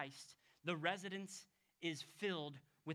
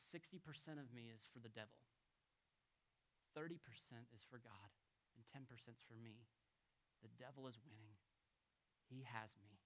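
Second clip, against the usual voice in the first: weeping son; 9 semitones lower, 14 dB quieter than usual. Retold or imitated. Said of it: "weeping and crying".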